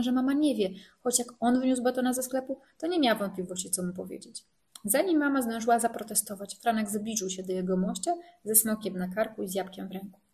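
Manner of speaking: ordinary delivery, nothing marked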